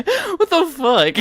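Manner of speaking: normal talking voice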